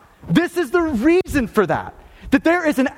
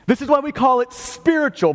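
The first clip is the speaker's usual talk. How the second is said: ordinary delivery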